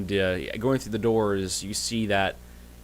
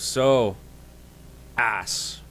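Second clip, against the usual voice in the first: dwarf greeting; 3 semitones higher than usual. Acted. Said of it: "And he goes".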